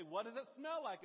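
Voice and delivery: loud, high reply; wife calling across the house, loud; wife calling back. Retold or imitated. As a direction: shout the question back. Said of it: "she'll say".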